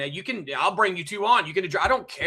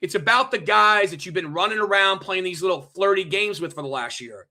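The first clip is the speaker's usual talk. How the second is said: ordinary talk, nothing marked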